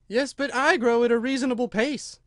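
high, loud huffy retort; huffy Dormouse. A narrator's reading. Dormouse argues with Alice about growing, high and huffy. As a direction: argue back in a high character voice.

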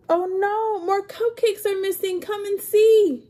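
alarmed exclaim. Acted. Storyteller calling the kids to see the missing cupcakes. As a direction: sound the alarm to everyone.

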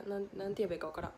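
ordinary talk

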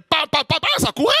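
quick high pa pa pa; mock running noises. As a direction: patter like fleeing feet. Imitating fleeing demons.

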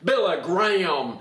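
put-on pulpit oratory; Billy Graham. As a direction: stretch the syllables in a mock revivalist voice. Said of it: "like to talk like Billy Graham".

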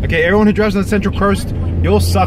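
loud, fast mock rant at bad drivers. Real, not acted. Friend ranting loudly at the Central Coast drivers.

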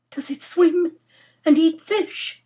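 high, pleading question; fervent hope. Acted. Nora praying it is an otter, fervent hope. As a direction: ask it like a desperate prayer, high and loud. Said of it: "with a, a fervent prayer in her heart".

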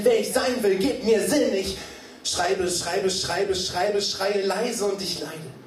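spoken-word build; slam poetry recitation. Acted. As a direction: recite with mounting urgency.

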